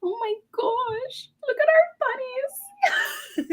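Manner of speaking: high, slow delighted squeal. Real, not acted. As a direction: gush over the bunnies. Gushing over both bunnies.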